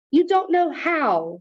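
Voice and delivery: slow, high angry protest. A narrator's reading. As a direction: snap it back slowly. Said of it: "said Grandma angrily".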